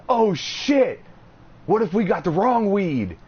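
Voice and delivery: high, hurried retold fright; mock panic. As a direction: play the stoned scare up. I'm all scared and shit.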